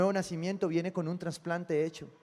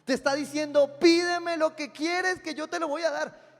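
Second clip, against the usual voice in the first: high, loud preacher's call; preaching at full force; 9 semitones higher, 7 dB louder than usual. Acted. Pastor preaching to his congregation, loud and high. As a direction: proclaim it to the crowd, raised and ringing. Pastor voicing the Lord's offer.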